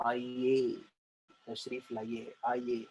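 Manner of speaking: low, soft, stately welcome; old courtly invitation. Acted. Showing students how kings' courts invited people, courtly and soft. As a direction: invite humbly as to a royal guest. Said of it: "बुलाते कैसे थे?".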